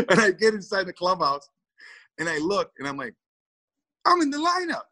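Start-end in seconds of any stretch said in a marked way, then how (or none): 4.0-4.8 much higher, thrilled realization; sudden delighted surprise; excited disbelief. Retold or imitated. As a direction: blurt it out like you can't believe your luck. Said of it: "I'm all excited".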